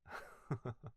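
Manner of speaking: a quiet breathy chuckle; soft laugh. Acted. Butler chuckles when the lady teases him, quiet breathy laugh. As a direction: chuckle softly, caught out. Teased about being worried.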